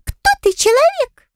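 high, thin squeaky pixie. A narrator's reading. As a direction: squeak it like a tiny fairy. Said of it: "пропищала".

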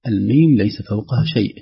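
ordinary talk, nothing marked

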